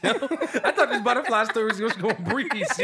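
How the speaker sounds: high, loud laughing disbelief. Real, not acted. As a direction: laugh out every word. Cracks up at moth tangent.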